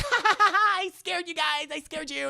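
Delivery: high, loud mock cackle into teasing; gloating laugh. Prankster to the friends she scared, high gloating laugh. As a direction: crow it in a high put-on voice.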